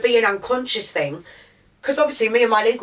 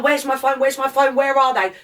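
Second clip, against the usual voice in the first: higher-pitched, urgent retold panic; 5 semitones higher, 3 dB louder than usual. Man retelling his hunt for his phone, mildly panicked. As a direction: replay a frantic search in raised pitch. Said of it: "I'm panicking. I'm looking around".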